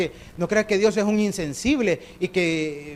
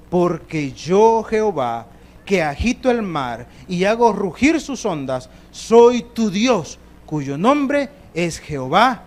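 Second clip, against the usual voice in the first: slow, loud declaration; preacher reading God naming himself; proclaiming; 5 dB louder, slower than usual. Acted. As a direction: proclaim each word as God. Proclaiming God's name slowly.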